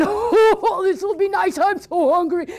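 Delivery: high, laughing greedy troll; gleeful troll. Acted. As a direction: relish the meal with a greedy laugh.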